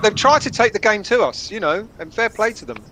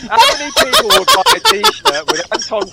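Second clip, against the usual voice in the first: laughing through it; 4 dB louder than usual. Real, not acted. Laughing after friend's impression.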